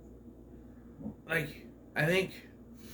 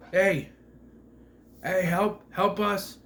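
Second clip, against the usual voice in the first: raised, higher shouts for help; calling for help; 6 semitones higher, 5 dB louder, slower than usual. Retold or imitated. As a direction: call out to distant soldiers. Soldiers spotted, calling for help.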